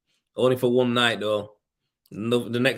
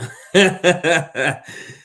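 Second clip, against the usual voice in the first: laugh; 7 semitones higher, 8 dB louder than usual. Real, not acted. Laughs at his own beer joke.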